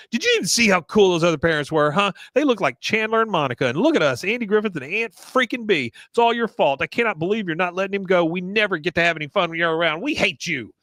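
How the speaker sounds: high, fast nagging complaint; Peggy whining. Retold or imitated. Mother berating husband over cancelled party, high fast whine. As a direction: rattle off a shrill-pitched gripe at the husband.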